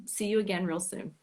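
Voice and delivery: ordinary delivery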